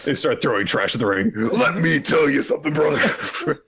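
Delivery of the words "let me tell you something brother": higher-pitched wrestler promo voice; Hulk Hogan. Acted. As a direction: mimic a hammed-up wrestling promo. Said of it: "He's perfectly traced Hulk Hogan".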